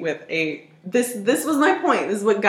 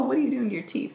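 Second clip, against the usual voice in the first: ordinary talk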